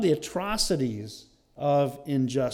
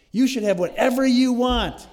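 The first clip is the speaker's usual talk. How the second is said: high, loud goading wife; hammed-up insistence, much higher and louder; Jezebel; 8 semitones higher, 8 dB louder than usual. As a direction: push the sulking husband with mock outrage. Acting Jezebel urging on the king.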